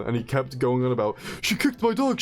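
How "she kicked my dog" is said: higher, whiny mock of the caller; mimicking screaming caller. Mocking the caller who kept screaming about his dog, in a high whiny voice. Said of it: "the more he was screaming at me".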